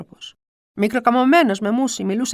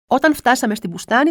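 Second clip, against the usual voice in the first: ordinary delivery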